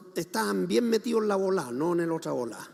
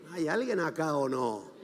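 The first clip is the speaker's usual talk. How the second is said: lower, soft check for response; quiet challenge; 5 semitones lower, 7 dB quieter than usual. Real, not acted. Preacher checking the congregation is still there, quiet challenge. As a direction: drop the voice and ask the room. Same challenge, now said low.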